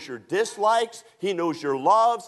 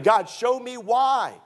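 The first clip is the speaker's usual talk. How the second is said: higher, drawn-out mock demand; stretched, raised-pitch imitation of a plea; demanding plea to God; 6 semitones higher, slower than usual. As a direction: act out a believer insisting on answers. Voices a believer demanding answers from God.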